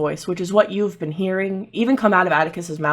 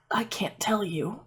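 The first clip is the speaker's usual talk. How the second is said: hushed, breathy whisper; secretive whisper; 2 semitones higher, 10 dB quieter than usual. Acted. Game master's character refusing to tell the group, whispered. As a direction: whisper it under your breath.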